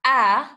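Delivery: the marker "A" said alone, loud and short; loud a sound. Shown as a demonstration. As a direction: hit "A" as a clear short open vowel. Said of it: "What do we say?".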